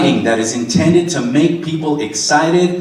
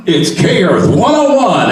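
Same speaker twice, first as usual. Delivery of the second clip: high, loud, drawn-out station ID; old-time radio announcer; 6 semitones higher, 5 dB louder than usual. Retold or imitated. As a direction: belt out a hammed-up vintage jingle read. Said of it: "every commercial was like this".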